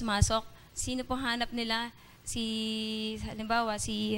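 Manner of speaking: ordinary delivery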